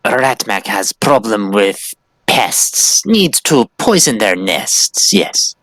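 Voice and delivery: slow, stilted third-person creature voice; scheming rat-creature. Acted. Creature explaining pest problem to merchant, slow scheming voice. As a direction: spell out the need slowly and slyly.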